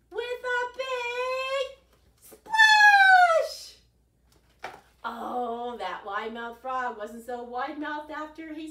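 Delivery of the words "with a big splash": big splash. A narrator's reading. Frog leaps into the pond.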